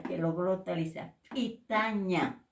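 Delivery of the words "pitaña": slow PITAÑA with an ejective popped p; glottalized P. Shown as a demonstration. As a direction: pop the p of PITAÑA with the throat closed.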